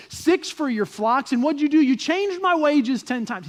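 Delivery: acted indignant worker airing complaints; Jacob, disgruntled employee. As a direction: passionately defend yourself, building in force. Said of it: "sounds kinda like a disgruntled employee".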